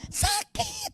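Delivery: high, strained cry of pain; sudden high ouch of pain; pained yelp. Retold or imitated. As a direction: yelp as if the sore was touched. Preacher reenacts painful boil.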